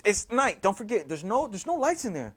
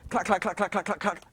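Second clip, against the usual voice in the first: soft, lower clack-clack patter; rapid clacking noise; 4 semitones lower, 6 dB quieter than usual. Retold or imitated. Something lands and runs across the floor, soft clacking. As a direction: imitate light, even running clatter.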